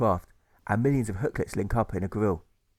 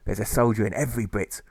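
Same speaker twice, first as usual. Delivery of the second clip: ordinary delivery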